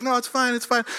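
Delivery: high little brush-off; tearful wife. Retold or imitated. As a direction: lightly mimic her saying it through tears. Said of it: "got her head down".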